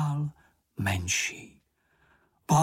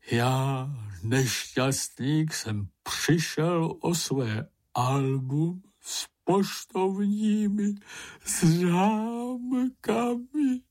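slow wail rising high at the end; moaning wail; 5 semitones higher, much slower than usual. A narrator's reading. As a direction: lament it, stretching into a wail. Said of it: "zaúpěl Bambul". (táhlé naříkání)

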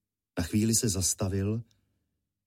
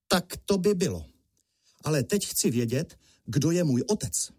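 put-on resolute young hero, higher pitch; firm demand; 6 semitones higher than usual. A narrator's reading. Boy to his mother after the fight, firmly demanding. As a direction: demand an answer, voice raised in pitch.

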